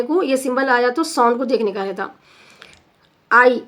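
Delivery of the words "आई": आई said alone, loud, gliding ah to ee; emphatic teacher's आई; diphthong demo. Shown as a demonstration. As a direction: sound out आई as a model vowel. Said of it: "आई sound आता ये".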